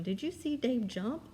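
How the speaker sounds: asking somebody, "jump" rising at the end; genuinely asking, voice going up on "jump"; question intonation. Shown as a demonstration. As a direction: lift "jump" as a real question. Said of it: "like if I were asking somebody that question".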